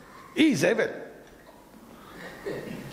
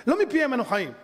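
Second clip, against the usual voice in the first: usual speaking voice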